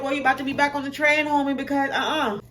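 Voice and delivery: put-on girl brushing the boy back onto the train; sassy dismissal. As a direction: shoo him off with attitude.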